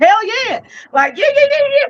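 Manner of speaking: high, whooping hell yeah; excited cheer. Retold or imitated. Zeus cheering on Medea, high and whooping. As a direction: hype her up like a fan.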